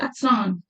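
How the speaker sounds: अस्नान said with an extra a at the start, "as-naan"; added vowel. Shown as a demonstration. Teacher demos the svaragam error to students: अस्नान with an added a. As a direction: put a vowel in front of स्नान: अस्नान. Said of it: "तो इसको ऐसे बोलते हैं".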